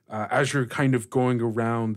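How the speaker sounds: usual speaking voice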